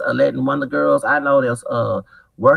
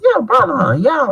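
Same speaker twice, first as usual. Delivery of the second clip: excited greeting; 13 semitones higher, 5 dB louder than usual. Retold or imitated. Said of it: "I said".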